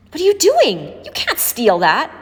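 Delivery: high, loud shocked protest. A narrator's reading. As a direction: stop him stealing, outraged. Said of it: "Raven protested loudly".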